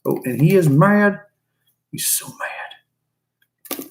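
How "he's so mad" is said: slow hushed whisper. Real, not acted. Keeper to camera about the angry snake, whispering. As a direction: whisper it slowly as if not to provoke him.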